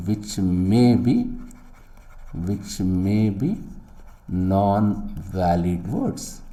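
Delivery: slow, word-by-word dictation; slow emphasis. Shown as a demonstration. Lecturer stressing a key point, slow and deliberate. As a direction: spell it out slowly for the students. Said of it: "That's why I'm emphasizing".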